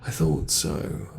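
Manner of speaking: ordinary talk, nothing marked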